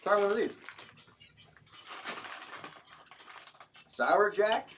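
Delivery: chewing while humming; mouth full. Real, not acted. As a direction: mmm through a mouthful. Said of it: "talk with my mouth full".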